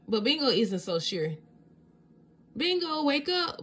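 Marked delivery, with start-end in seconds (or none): 2.6-3.6 high, slow call to wake her sister; wake-up shout. A narrator's reading. Bluey waking Bingo on Easter morning, high calling shout. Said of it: "Bluey shouts the next morning".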